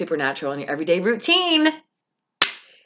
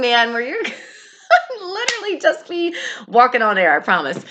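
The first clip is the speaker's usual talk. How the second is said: laughing; 8 semitones higher, 4 dB louder than usual. Real, not acted. Laughing while describing euphoria.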